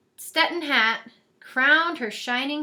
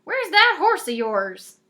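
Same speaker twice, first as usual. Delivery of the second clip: brisk; 4 semitones higher, 6 dB louder than usual. A narrator's reading. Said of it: "said her fairy godmother briskly".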